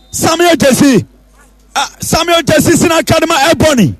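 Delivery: normal talking voice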